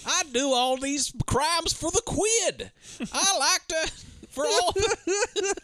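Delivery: high, very slow British caricature; mock British accent. Riffing on bad movie accents, hammed-up slow British accent. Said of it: "should have been given an accent".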